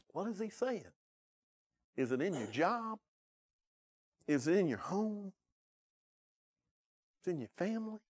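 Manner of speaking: high, climbing questions hammered at the pews; rising preacher questions. Acted. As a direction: press each question home from the pulpit. Preacher presses the congregation.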